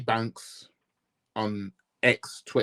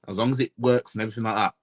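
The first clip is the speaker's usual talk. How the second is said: normal talking voice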